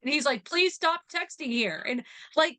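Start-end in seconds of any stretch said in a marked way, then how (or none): 0.4-1.8 mock plea. Voices uncle's plea in story.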